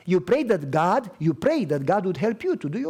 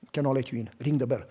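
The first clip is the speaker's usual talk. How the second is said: lower gruff refusal; 7 semitones lower, 4 dB quieter, faster than usual. Retold or imitated. Biker guy refusing her entry.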